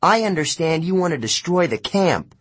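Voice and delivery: high, angry yell. A narrator's reading. Annabeth yelling at Luke over the camp. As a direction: throw it at him hard. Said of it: "she yelled".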